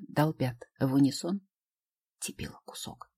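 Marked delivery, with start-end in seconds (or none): 2.2-3.1 muttered under breath; whisper. Acted. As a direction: whisper it to yourself. Quiet self-insult.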